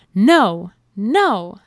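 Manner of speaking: loud, firm "no, no"; firm old hen. A narrator's reading. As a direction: stop the chicks sharply. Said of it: "said the old hen".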